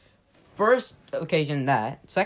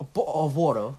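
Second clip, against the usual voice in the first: bo'o' of water with dropped t's in a clumsy accent; amateur British accent on bottle of water; bad British-style accent. Shown as a demonstration. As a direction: attempt a glottal-stop British accent. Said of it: "I'm bad at accents".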